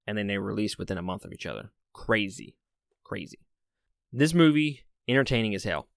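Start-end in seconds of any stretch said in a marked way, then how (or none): 3.0-3.4 quiet echo. Real, not acted. Reviewer softly echoing himself, quiet. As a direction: drop it to a soft repeat. Repeats the word, second quieter.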